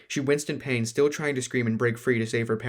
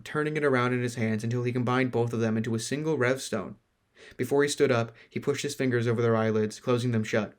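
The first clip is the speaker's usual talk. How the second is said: ordinary delivery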